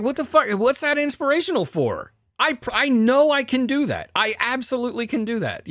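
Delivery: high-pitched, exasperated retold protest; incredulous rant at a high pitch; angry outburst. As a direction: argue back loudly in mock outrage. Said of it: "I was so angry".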